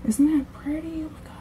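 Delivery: breathless little whisper. Real, not acted. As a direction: whisper it while doing lashes. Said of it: "or you have to whisper at least".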